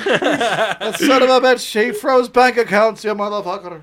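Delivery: slow, high Irish brogue; mock Irish accent. Fake Irishman cursing Trudeau over bank accounts, slow mock Irish accent. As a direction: drag out the grievance in a hammed-up Irish accent. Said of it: "I'm an Irishman living in Canada".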